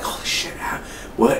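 hushed, breathless whisper of panic; frightened whisper. Retold or imitated. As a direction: whisper it like you just woke up chained. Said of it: "she's freaking out".

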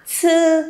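SƯ with air pushed out, then held high and level; aspirated consonant. Shown as a demonstration. Teacher repeating for students, aspirated SƯ. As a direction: puff out the start of SƯ and sustain. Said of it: "bật hơi ra nào".

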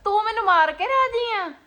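sharp, high angry retort. Real, not acted. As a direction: snap back, hurt and angry. Wife scolds husband over medicine.

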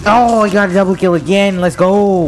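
loud, high celebration; excited cheer. Real, not acted. Gamer celebrating a double kill, loud excited cheer. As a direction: shout it, thrilled at your own success.

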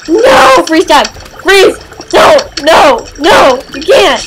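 loud, very high shouts; frantic screaming. Real, not acted. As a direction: scream at the game in panic. Frantic game moment.

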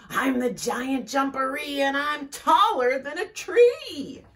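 high, loud, put-on monster boast. Hidden creature scaring Rabbit from the burrow, loud showy boast. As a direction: boom it out to sound scary. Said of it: "he heard a loud voice".

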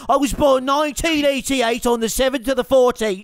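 cocky mock Irish accent, lightly done; a gamer playing brash Irish fighter Conor McGregor; swaggering put-on Irish brogue. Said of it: "you literally sound so fucking funny".

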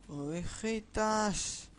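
drawn-out call to little sheep. Pretend. Player calls the missing sheep in a game, drawn-out call.

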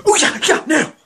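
high, loud yelping; very high excited shout; startled outburst. Acted. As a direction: yelp it out in a burst. Startled outburst in acted scene.

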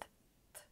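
t as a bare voiceless puff; voiceless t demo; whispered t. Shown as a demonstration. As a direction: breathe out the t sound. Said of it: "pronounced as unvoiced".

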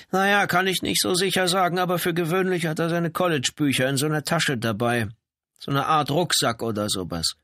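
put-on high-pitched older-neighbour chattiness; chatty housebound wife. A narrator's reading. Janitor's wife tells police of the tenant's habits, chatty. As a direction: play the watchful wife, pitched well up.

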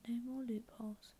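usual speaking voice

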